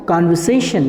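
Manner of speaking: कन्वर्सेशन as kanvaseshan, R silent; correct pronunciation. Shown as a demonstration. Teacher drilling students on the right word, no R. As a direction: give कन्वर्सेशन with an open ka and no R. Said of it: "आप इसको कन्वर्सेशन कहिए".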